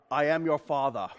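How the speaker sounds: lightly voiced low movie villain; movie villain line. Preacher quoting a misremembered movie line, low villain voice. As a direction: intone it low, like a famous film quote. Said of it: "Which actually is a misquote".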